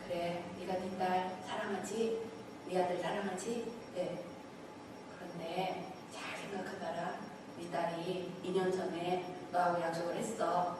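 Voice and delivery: soft, hushed fatherly voice; gentle voice of God. Retold or imitated. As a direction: speak low and tender, like a father to a child. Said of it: "하나님께서 저에게 어떻게 얘기를 해주셨냐면".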